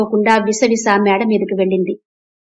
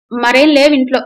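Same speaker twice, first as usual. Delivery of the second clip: cook's sullen louder reply; sullen grumble; 4 semitones higher, 6 dB louder than usual. A narrator's reading. Cook sulkily telling the mother there's nothing else. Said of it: "Iyer మొహం ముటముటలాడించుకుంటూ అన్నాడు".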